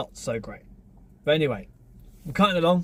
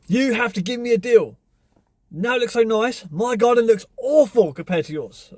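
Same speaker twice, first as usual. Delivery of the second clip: higher, louder whiny neighbour voice; mock pushy neighbour; 7 semitones higher, 4 dB louder than usual. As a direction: pitch it up to mock the haggling neighbour. Neighbour begging for a deal.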